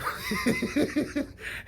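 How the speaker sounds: very high-pitched burst of laughter; amused laugh. Real, not acted. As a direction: crack up in a high cackle. Laughs at the presidential dream.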